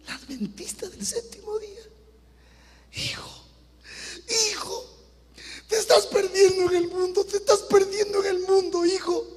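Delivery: high, crying mother's plea; weeping plea to a wayward son; tearful mother. Retold or imitated. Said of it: "Y estaba botando lágrimas".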